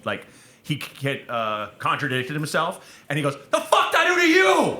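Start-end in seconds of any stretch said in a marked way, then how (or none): 3.5-4.8 snapping, high-pitched angry shout; mock an explosive outburst; Marc Maron. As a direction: yell it like a man who just snapped. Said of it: "he just, he just snapped".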